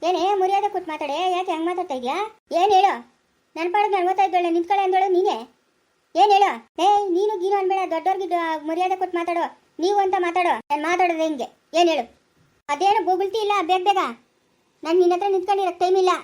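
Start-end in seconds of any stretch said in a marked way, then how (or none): 2.6-9.4 angry shouting. Acted. Mother-in-law and girl quarrelling, she shouts back furiously. 9.8-16.1 angry scolding. Acted. Quarrel continues, mother-in-law scolds.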